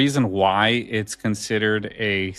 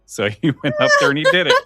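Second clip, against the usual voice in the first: ordinary talk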